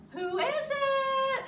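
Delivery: loud call. Acted. Bear asks loudly.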